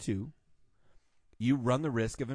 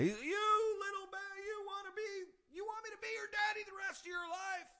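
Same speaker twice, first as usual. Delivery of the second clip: drill sergeant; 6 dB quieter than usual. Retold or imitated. Drill instructor confronting a little kid on stage, hammed-up rant. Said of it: "I'll back up and do my best".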